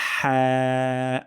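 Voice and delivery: হ্যাঁ stretched slowly with a thin breathy front vowel; হ্যাঁ drawn out thin; long light ha. Shown as a demonstration. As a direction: let the air out on a light হ্যাঁ. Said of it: "আমরা পাতলা করে উচ্চারণ করব".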